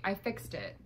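usual speaking voice

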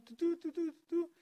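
high-pitched "doo doo" dialing beeps; sing-song electronic beeps by mouth; imitated phone tones.

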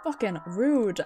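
ordinary delivery

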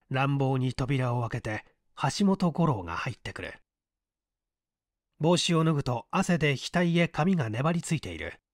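usual speaking voice

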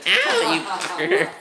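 very high, drawn-out yelp; mock pained squeal. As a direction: act out being hit in a squeaky high voice. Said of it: "it should have been".

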